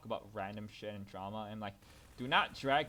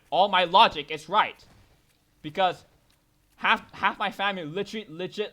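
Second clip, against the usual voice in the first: loud rant; 6 semitones higher, 14 dB louder than usual. Real, not acted. Ranting about family.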